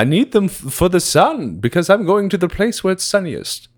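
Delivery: high, theatrical villain voice; Pegasus. Acted. Explaining the glasses to the host, Pegasus impression. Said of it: "You do Pegasus".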